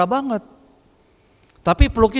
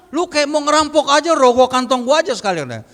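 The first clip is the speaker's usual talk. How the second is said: high-pitched, retold accusation; imitated scornful protest at the price; mock indignation; 6 semitones higher, 4 dB louder than usual. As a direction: act out a buyer who feels robbed. Friend acted accusing painter of robbery.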